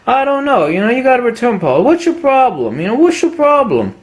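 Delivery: high, fast, pushy clerk voice; mock dismissive clerk. Clerk waving away the question about fungus, mocking high voice. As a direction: play the used-gear clerk brushing the caller off. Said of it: "It's like".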